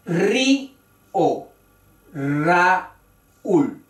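"Río, Raúl" broken as rí-o, ra-úl; sílaba por sílaba. Shown as a demonstration. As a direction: pause between the two syllables of each word in "Río, Raúl". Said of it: "son palabras de dos sílabas". ("Río, Raúl" separado en dos sílabas cada una)

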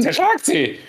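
high eerie echo of the box. Retold or imitated. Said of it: "Hat's selbst gesagt".

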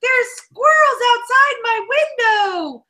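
put-on high, squealing little kid, thrilled to bits; excited child voice. Daughter Romy waking her parent, gushing excitedly. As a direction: gush like a small child who just spotted squirrels. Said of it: "Romy has been waking up every morning going".

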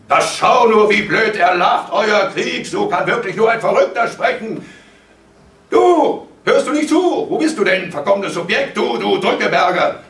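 high, loud tirade of abuse; furious scolding at full voice; raging father. A narrator's reading. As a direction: shout him down, incredulous. Father rages at laughing son.

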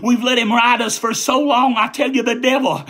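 usual speaking voice

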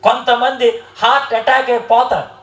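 normal talking voice

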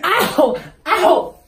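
sharp loud cries of real pain; pained yelps. Real, not acted. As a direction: yelp each time the claw lands. Clawed by cat.

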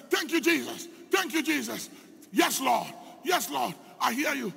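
high, loud, shouted thanks to Jesus; ecstatic praise. Retold or imitated. Man praising God during prayer.

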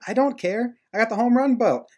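cocky retort pitched high; mock defiance. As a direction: imitate a player brushing it off, high-voiced. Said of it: "He's like".

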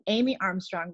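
usual speaking voice

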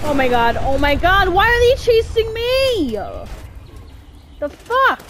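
high, loud panicked shouting; frantic yelling. Real, not acted. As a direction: shout in terror while fleeing. Gators chasing the player.

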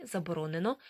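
usual speaking voice

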